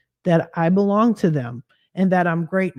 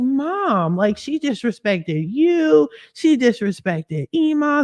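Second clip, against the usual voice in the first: higher-pitched, drawn-out complaint; indignant retold protest; 7 semitones higher, slower than usual. Daughter telling mom who was disrespected, in high indignation. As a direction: plead your case, leaning on each name.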